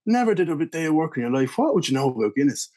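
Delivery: high-pitched, scornful Irish drinker; very Irish impression. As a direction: dismiss them like an Irish pub regular. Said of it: "this would be a very Irish impression".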